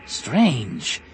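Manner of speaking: rasping character voice; rasped. A narrator's reading. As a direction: rasp it out in character. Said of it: "Tizar Sebatine rasped".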